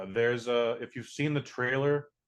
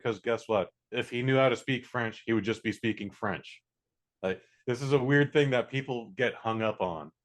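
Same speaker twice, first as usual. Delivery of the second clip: normal talking voice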